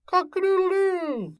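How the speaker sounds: very high crowing; rooster crow. Acted. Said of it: "crowed the cock".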